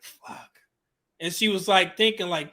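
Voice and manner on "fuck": barely audible curse under his breath; muttered curse. Retold or imitated. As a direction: let it slip out almost silently, dismayed. Realizing grandmother is sad.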